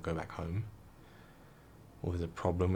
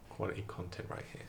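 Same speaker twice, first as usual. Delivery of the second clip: deadpan sarcastic aside; 8 dB quieter than usual. Real, not acted. Sarcastic aside about own story.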